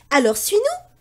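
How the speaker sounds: put-on high boyish command; a kid waving others on; bold boy. Pierre tells the duck to follow, high.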